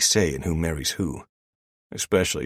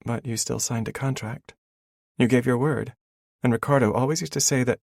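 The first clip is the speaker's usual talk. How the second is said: put-on higher, insistent young woman; female character voice; 9 semitones higher, 4 dB louder than usual. A narrator's reading. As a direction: press the point as the heroine. Elena pressing him.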